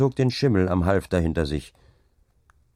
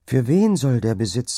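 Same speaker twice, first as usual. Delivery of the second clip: higher, louder questioning wife; troubled objection; 6 semitones higher, 5 dB louder than usual. A narrator's reading. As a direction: challenge your husband with a troubled question. Wife's anxious objection to husband. (bekümmert einwendend)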